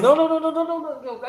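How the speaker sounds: high laughing denial, no after no. Real, not acted.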